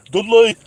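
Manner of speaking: Dudley! yelled high and loud in a broad local twang; mock Dudley shout. Reading a viewer's message, then shouting his town's name. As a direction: belt out Dudley like a proud local. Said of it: "You can't say it like that".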